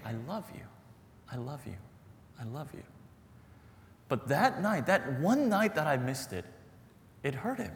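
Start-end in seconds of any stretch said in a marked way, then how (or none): 0.0-2.8 tender, softer. Retold or imitated. Father's daily words to son.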